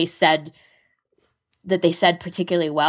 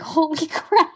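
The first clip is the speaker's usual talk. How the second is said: shocked; slower than usual. Retold or imitated. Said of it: "and I was like".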